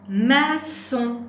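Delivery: loud, high, deliberate model word; word dictation. Shown as a demonstration. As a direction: call out each syllable clearly for a learner. Teacher dictates word for syllable listening.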